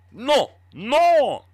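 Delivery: loud, high mock French refusal; badly faked French accent, loud and high. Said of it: "I can't do the French accent either".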